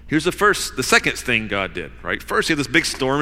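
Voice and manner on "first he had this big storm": ordinary delivery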